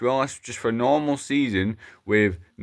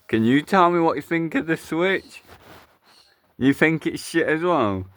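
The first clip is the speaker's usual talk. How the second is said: higher puppet voice; 5 semitones higher than usual. Acted. Puppet character asks viewer.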